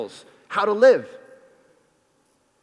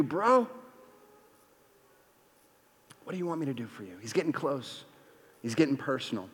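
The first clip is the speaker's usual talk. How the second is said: much softer and lower, drawing listeners close; hushed sermon; 5 semitones lower, 5 dB quieter than usual. Acted. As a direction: lean in and speak it softly, like a secret. Hushed intimate stretch of sermon.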